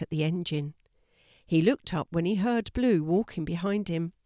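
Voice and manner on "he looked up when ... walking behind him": ordinary delivery, nothing marked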